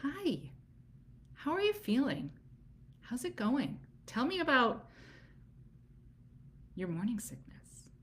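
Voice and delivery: soft, interested doula voice; gentle doula check-in. Shown as a demonstration. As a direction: ask gently, like you have all the time. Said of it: "get to come in and be like".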